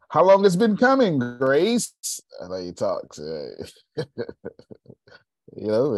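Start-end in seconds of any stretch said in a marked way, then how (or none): 0.1-1.9 higher and louder imitation; mock Jim Rohn. Quoting Jim Rohn to Grace, loud and high. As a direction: boom the quote back at Grace. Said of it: "It's a, it's".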